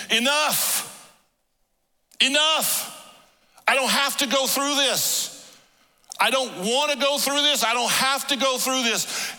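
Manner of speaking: high, loud anguished outcry; crying out enough. Retold or imitated. Jesus crying out to end the blows, loud and high. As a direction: cry out as if at breaking point.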